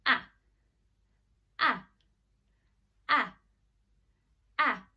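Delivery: "Ah" kept short and crisp; short, separate "Ah" sounds. Shown as a demonstration. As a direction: cut each "Ah" off quickly. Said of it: "keep it nice and short".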